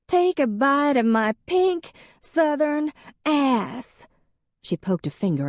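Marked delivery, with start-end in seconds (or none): 0.1-4.1 put-on high, slow, louder drunken jab; drunk southern insult. A narrator's reading. Drunk ex-wife poking Em's chest, high slow drunken sneer. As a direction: spit it out slow and nasty.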